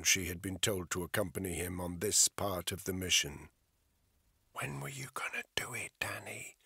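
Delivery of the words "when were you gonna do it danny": soft, breathy threatening whisper; woman at gunpoint whispering her suspicion. A narrator's reading. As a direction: hiss it close and cold. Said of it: "she whispered".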